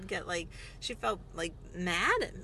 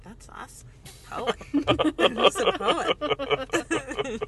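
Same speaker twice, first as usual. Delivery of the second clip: laughing mock awe; 3 semitones higher, 4 dB louder, much slower than usual. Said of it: "I would be like".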